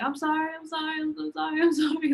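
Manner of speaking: high, frantic, repeated apologies; a teen panicking as her dad breaks down; panicked apology. Retold or imitated. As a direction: blurt out sorry in a flustered rush. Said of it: "I was like".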